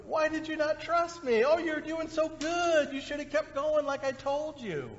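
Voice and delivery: put-on high, bright coaxing voice to a child; gentle encouraging parent. Parent encouraging a child after a bike fall, gentle. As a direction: cheer the kid on kindly. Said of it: "No, you say".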